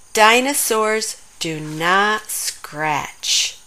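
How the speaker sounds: very slow, each word laid out; slow recital. Acted. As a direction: recite the rule deliberately. Quoting the sign proudly.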